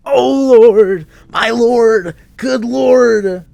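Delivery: very high, loud hammed-up wail; theatrical lament. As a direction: overplay a grief-stricken preacher. Dramatic figure cries to heaven.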